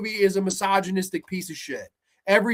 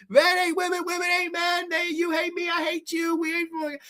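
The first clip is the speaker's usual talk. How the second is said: higher mock shouted chant; mock shouting witches; 9 semitones higher than usual. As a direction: yell their slogan like a parody. Mocking the witches' logic.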